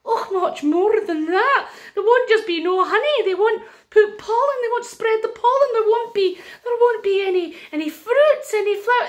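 high, loud, insistent character voice; agitated wise woman. Acted. Wise woman warning the king of disaster, agitated.

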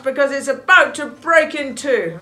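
loud, firm soldier barking his captain's orders; toy soldier. Acted. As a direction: stand guard and lay down the rule.

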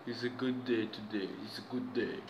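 soft murmur between bites, like ASMR; quiet savoring murmur. Real, not acted. Murmuring while savoring food.

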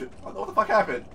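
ordinary delivery

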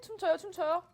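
excited. Real, not acted. Delighted at dancing food.

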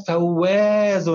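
"فواز" with a long drawn-out aa; stretched long vowel. Shown as a demonstration. Teacher demonstrates the madd to pupil, long aa in "فواز". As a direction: hold the alif in "فواز". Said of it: "بها أيضًا مد بالألف".

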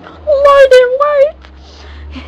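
high, loud desperate call; pleading cry. A narrator's reading. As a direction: beg her to stop. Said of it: "The prince kept pleading".

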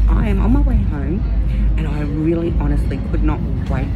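muffled through a mask; talking behind a face mask. Real, not acted. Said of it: "I hope you can hear me through my mask".

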